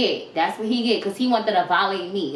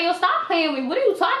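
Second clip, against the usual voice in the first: higher, raised voice, mock disbelief; incredulous comeback; 8 semitones higher, faster than usual. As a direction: throw it back at him in disbelief. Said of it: "I'm like".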